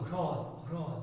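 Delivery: হ্রদ said softly, with both h and r; correct pronunciation. Shown as a demonstration. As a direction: quietly model হ্রদ, h and r touching. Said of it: "হ এবং র দুটোকে নিতে হবে". (সঠিক উচ্চারণ, হ্রদ, হ আর র দুটোই)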